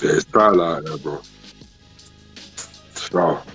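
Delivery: ordinary delivery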